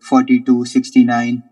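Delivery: slow, loud, flat robot announcer. Acted. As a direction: drone it out like a robot. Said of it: "That is the fakest robotic voice I've ever heard".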